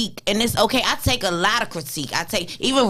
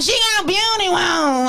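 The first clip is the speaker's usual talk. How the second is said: put-on excited child's cry; 8 semitones higher than usual. The girl telling her family, high excited shout. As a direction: blurt it out like an excited kid. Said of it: "she was like".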